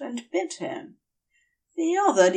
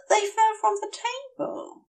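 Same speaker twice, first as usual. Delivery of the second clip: worried Aziraphale; 13 semitones higher, faster than usual. Acted. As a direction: ask it small and worried.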